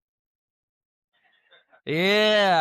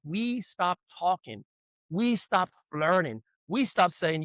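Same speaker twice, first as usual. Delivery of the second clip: normal talking voice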